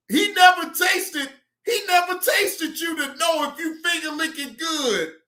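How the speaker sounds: high, loud shouting disbelief; loud incredulous rant. Real, not acted. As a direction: hammer the question loudly.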